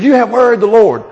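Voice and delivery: loud and high, thundering at them; booming prophet. Acted. Malachi storming out of the woods at the people, thundering. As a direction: burst out of the bushes and boom it.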